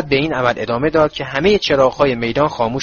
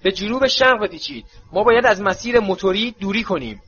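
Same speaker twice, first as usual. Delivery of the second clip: Moody shouting; 6 semitones higher than usual. A narrator's reading. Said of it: "مودی باز هم فریاد زد".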